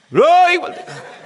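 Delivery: high, loud, slow hammed-up yell, laughing; mock rough yell. Preacher imitates rude shouting while laughing, hammed-up yell. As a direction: caricature the rowdy call. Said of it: "ऐसा बोलना".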